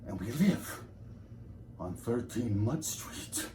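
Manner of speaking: soft, halting, with long pauses; gloomy reminiscence. Acted. Lecturer brooding on failed life and thirteens, hushed gloom. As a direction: sink slowly into hushed despair.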